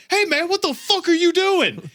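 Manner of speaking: high-pitched holler across the street; hammed-up outraged challenge; mock indignant yell. Retold homeless man yelling across street.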